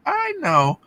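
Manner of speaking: high-pitched, playful "I know"; coy retold reply. Seller teasing a customer at a pop-up, coy.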